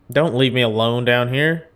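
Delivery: ordinary talk, nothing marked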